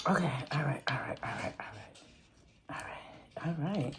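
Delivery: very soft, half-whispered, low mumbling; muttering to self. Real, not acted. Creator to self while working at the ball, muttering. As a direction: murmur it under your breath.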